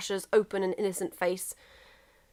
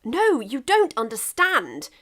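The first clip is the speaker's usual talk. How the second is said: pressing frantically; 4 semitones higher, 4 dB louder, slower than usual. Acted. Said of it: "England pressed frantically".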